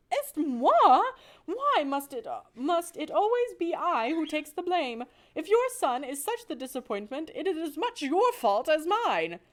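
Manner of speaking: French mother mouse arguing with husband; indignant French accent. Acted. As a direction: throw the blame back in French style. Wife indignant back at husband.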